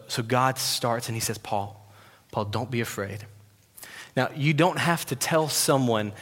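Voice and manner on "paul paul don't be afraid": soft, hushed comforting voice; tender and very soft, acted as God; gentle reassurance. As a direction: soothe him quietly by name. God comforting frightened, exhausted Paul.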